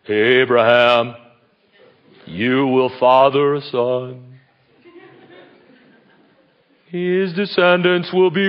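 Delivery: slow, drawn-out solemn pronouncement; booming voice of God. Acted. God announcing a son to Abraham, slow and grand. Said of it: "We picture God in whatever form he took to Abraham saying".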